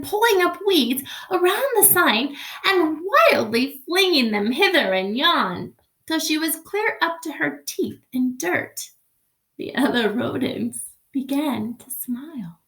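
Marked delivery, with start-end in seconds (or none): none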